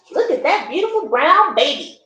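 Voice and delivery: plain reading voice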